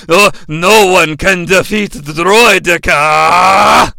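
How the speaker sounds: slow, stretched-out proclamation; mock menacing boast. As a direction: drag it out like a hammed-up villain. Hammed-up villain boast about Droideka.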